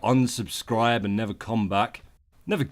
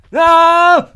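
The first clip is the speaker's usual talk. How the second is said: shout; 15 dB louder than usual. Acted. Shouted alien word.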